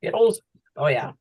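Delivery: talking while chewing; words around a mouthful of candy; mouth full. Real, not acted. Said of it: "I'm chowing down".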